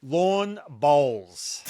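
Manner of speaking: LAWN BOWLS stretched out very slowly; exaggerated enunciation. Shown as a demonstration. Host repeating a queried term to teasing colleagues, slow and drawn out on "lawn bowls". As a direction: draw out both words, "lawn bowls", one at a time. Enunciates after accent is teased.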